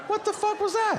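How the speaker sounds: squeaky, very high stunned question; baffled disbelief. Retold or imitated. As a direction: voice jumps way up in shock. Baffled reaction to neighbor.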